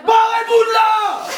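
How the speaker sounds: loud slow yell; shouting. Real, not acted. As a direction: shout them out of the room. Chasing friends from kitchen.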